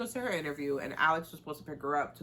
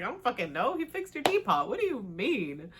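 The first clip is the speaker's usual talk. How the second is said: higher, loud and exasperated; 6 semitones higher, 4 dB louder than usual. Acted. Son snapping back at his mother, exasperated. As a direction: throw up your hands, voice cracking high.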